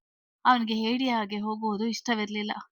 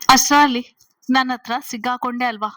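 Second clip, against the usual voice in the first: loud triumphant catch; 2 semitones higher, 8 dB louder than usual. A narrator's reading. Father catching the hidden boyfriend, loud triumphant pounce. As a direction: burst out loud on ಅಷ್ಟ್ರಲ್ಲಿ then press on.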